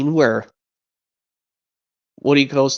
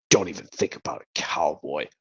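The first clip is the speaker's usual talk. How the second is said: tough-guy threat; 10 semitones lower, 8 dB quieter than usual. Acted. Tough-guy standoff between toys.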